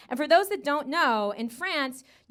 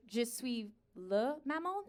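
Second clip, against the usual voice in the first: slow, soft, questioning lift at the end; tentative French, word by word; hesitant repeat; 7 dB quieter, slower than usual. Acted. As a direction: repeat it unsurely, dropping the volume. Unsure repeat after being misunderstood.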